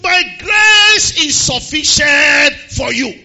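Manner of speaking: slow, loud preacher shout; preaching. Acted. Preacher shouting slowly to the church. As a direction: drive it home to the congregation.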